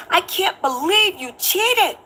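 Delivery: high, slow whiny disbelief; mock distraught. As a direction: play him wailing over the cheating. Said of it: "Then he said".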